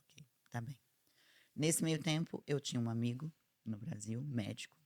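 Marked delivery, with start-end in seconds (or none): 0.0-0.8 breathy whispered okay; whispered resignation. Retold or imitated. A mother answering her son, whispered and resigned. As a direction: let it out under your breath.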